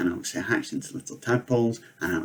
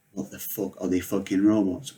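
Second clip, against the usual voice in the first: ordinary talk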